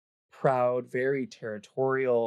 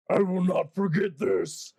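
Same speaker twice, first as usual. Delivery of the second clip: high, raised monster cry of defeat; manticore parting shriek; 6 semitones higher than usual. Acted. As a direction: shriek a threat while fleeing. Fleeing manticore yells parting threat.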